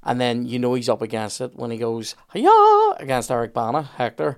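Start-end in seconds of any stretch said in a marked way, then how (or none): 2.3-3.0 a huge high shriek of "Hiya!" as he leaps in; a hammed-up martial-arts "Hiya!" shouted at full pitch; big mock battle cry. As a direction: yell "Hiya!" far higher and louder, like a movie hero lunging with a sword. Brad Pitt's Achilles, up against Hector.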